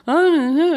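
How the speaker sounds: high-pitched drawn-out "ah" of a maiden; girlish rising squeal. Acted. Speaker mocks the maiden we shed, high girlish cry. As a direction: let out a high, girlish "aah". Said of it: "the voice that goes up".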